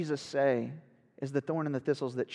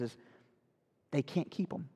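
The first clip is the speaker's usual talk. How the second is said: usual speaking voice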